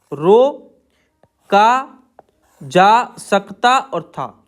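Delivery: very slow, stretching each part of रोका जा सकता था; slow, drawn-out dictation pace. Shown as a demonstration. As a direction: drag the words out while the class writes. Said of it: "आगे है रोका जा सकता था".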